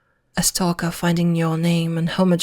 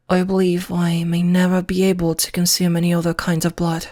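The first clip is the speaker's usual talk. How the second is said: usual speaking voice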